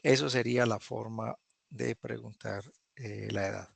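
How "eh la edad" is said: ordinary delivery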